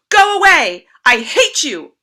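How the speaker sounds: put-on furious, loud and high child's yell; angry shout. An shouting at grandfather, furious. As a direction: scream it at grandfather. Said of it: "An shouted".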